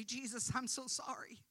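earnest, fervent model prayer; sample prayer. Shown as a demonstration. Preacher voicing a sinner's prayer for the listeners, fervently. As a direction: plead it with rising fervour. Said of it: "the moment you say".

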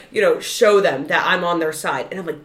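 ordinary delivery

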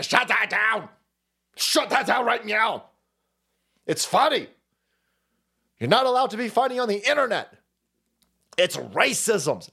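mock outraged demand, voice pushed up; mock indignant outburst. Mimicking censors who want jokes shut down, mock outraged. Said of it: "so they say".

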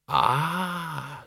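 light up with sudden understanding; mildly bright, dawning realisation; brightly. A narrator's reading. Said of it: "said Reg brightly".

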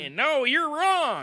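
high, slow mock indignation; mock outrage. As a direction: squeal it in fake outrage. Mock outrage at show critique.

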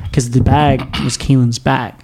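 ordinary delivery

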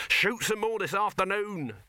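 high, triumphant order; crowing excitement. A narrator's reading. Dibbler carried away by his plans, high and crowing. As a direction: crow it eagerly. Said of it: "crowed Dibbler".

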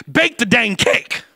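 slow, loud, high exasperation; exasperated. Real, not acted. Pastor fed up with cake refusers, exasperated shout. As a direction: hammer each word home.

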